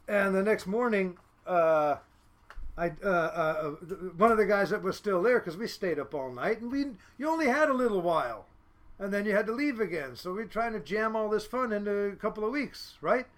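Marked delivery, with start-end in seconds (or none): none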